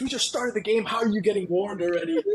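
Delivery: high-pitched retold disbelief; exasperated coach. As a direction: mimic the coach demanding an explanation. Coach scolding player in retold exchange.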